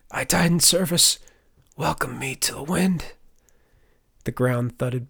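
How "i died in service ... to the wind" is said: strained, higher winded prayer; wheezed. A narrator's reading. Injured Turner prays to the divines, wheezing. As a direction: wheeze it out as if dying. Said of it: "he wheezed".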